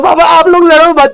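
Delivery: put-on high, loud weepy child's voice pleading; crying plea. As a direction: wail at the fighting parents to stop. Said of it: "और मैं रो रहा हूं". (रोते हुए ऊँची आवाज़ में गिड़गिड़ाना)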